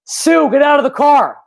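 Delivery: half-joking call to the dog. Retold or imitated. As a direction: bark an order at the dog in the car. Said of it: "I thought it would be funny to be like".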